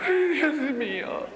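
high, slow tearful wail; hammed-up crying. Performer lamenting that people fear her, mock crying. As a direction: wail it out in self-pity.

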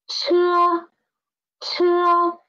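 车 said with a strong puff of air, aspirated. Shown as a demonstration. Teacher models a strongly aspirated 车 for the class. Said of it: "One is soft and one is strong".

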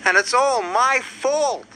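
high, slow self-blaming moan; little engine lamenting. A narrator's reading. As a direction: draw out a woeful confession, pitched high. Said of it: "moaned Thomas".

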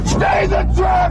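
urgent shout with a hard breathy edge; shouted order. Acted. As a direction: bark the order over the chaos. Ambush, ordering people to stay.

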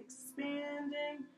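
slow, swelling EXPANDING to guide an inhale; drawn-out expanding. Shown as a demonstration. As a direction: stretch EXPANDING like a balloon filling up. Stretches word to match inhale.

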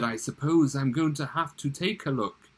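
ordinary delivery

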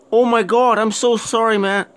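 high, loud rushed apology; sorry in a startled rush; flustered apology. Real, not acted. Gamer apologizing to a skeleton, flustered.

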